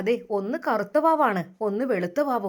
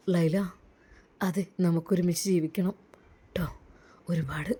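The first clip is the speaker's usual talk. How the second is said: put-on lower, gentle young man's voice; lower, tender groom talking to his bride-to-be; the groom's voice; 8 semitones lower, 4 dB quieter than usual. A narrator's reading. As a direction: speak as the suitor who wins her over. Doctor voiced in story.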